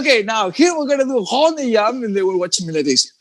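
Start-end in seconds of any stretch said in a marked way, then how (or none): 0.0-2.0 laughing through a mock announcement, "Honey Yam" mangled; teacher in Paris mangling rond de jambe, laughing. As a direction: pretend class call, giggling. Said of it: "imagine me going to Paris and saying that".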